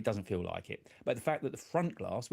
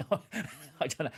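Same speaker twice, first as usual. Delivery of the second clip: laughing; 7 semitones higher, much slower than usual. Real, not acted. Laughing at Josiah's teasing.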